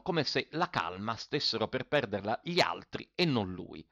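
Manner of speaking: plain reading voice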